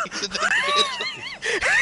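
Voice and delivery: high, squealing laugh through the words; helpless laughter. Real, not acted. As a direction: crack up while repeating what he said. Cracking up repeating teammate's line.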